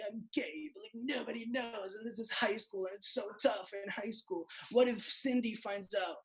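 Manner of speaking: hammed-up soap-opera confession; mock angst-ridden movie teen. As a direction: parody a tortured closeted teen. Mocking Hollywood coming-out drama.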